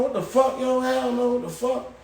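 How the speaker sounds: high, incredulous protest; retold indignant outcry. Inmate to guards refusing his cell, high indignant protest. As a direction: pitch it up and push back hard.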